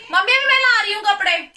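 loud, high call to another room; yelling across the room. Real, not acted. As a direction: shout back to mom far away. Answering mother in another room.

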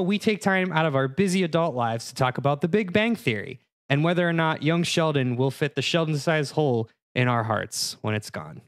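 usual speaking voice

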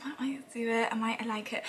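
whispered aside. Real, not acted. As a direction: breathe it as a secret.

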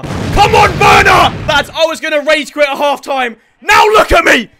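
high, loud goal celebration; roaring celebration; ecstatic shouting. Real, not acted. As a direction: yell in disbelief and joy.

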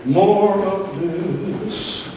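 very slow, stretched words; drawn-out savoring. Retold or imitated. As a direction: linger long on each word. Repeats his catchphrase after the shock.

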